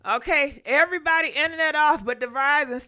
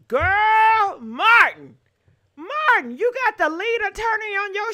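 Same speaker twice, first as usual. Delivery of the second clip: loud call of his name; calling out Martin; 5 semitones higher, 4 dB louder than usual. Real, not acted. Reacting to Martin's clip, calls out loud. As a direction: shout Martin's name across the room.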